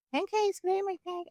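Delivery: light falsetto silliness; playful high voice. Acted. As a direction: toss it out high and small. Jokey aside inside acted quote.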